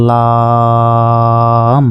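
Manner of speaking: লাম held very long, four alif; drawn-out Arabic letter. Shown as a demonstration. Teacher models a four-alif লাম for students, very slow. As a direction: stretch লাম slowly to four counts. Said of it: "ল আকারে লা আর ম".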